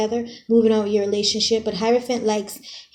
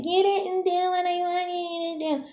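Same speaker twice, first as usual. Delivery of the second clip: mock whining; 8 semitones higher, 5 dB quieter than usual. Reader mimicking a clingy partner to her viewers, mock whining. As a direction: imitate a clingy, whiny partner. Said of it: "Don't be all clingy and whiny".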